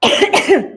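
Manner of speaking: sharp cough to clear throat. Real, not acted. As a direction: cough once, hard. Clears something from mouth.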